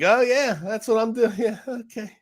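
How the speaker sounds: high, smug mock executive with a laugh; smug bank CEO. Retold CEO grinning at the congressman, smug and high. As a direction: play him as pleased with himself. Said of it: "smugly smiling".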